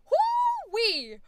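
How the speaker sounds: high, loud excited whoo-wee; whoop. Real, not acted. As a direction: cheer the breakthrough. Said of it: "excited exclamation".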